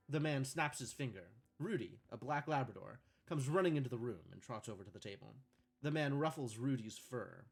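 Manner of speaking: calm scene-setting read; script narration. A narrator's reading. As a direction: read the stage directions evenly.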